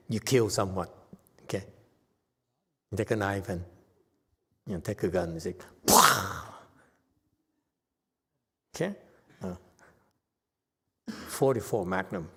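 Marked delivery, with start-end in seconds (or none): 5.9-6.5 mock gunshot. Mimes a gunshot with a gun.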